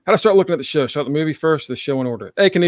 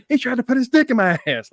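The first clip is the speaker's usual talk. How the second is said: high-pitched mock outcry; imitated complaining prostitute; 9 semitones higher, faster than usual. Quoting the prostitute at the brothel, mock outraged. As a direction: cry it out as a comic tattletale.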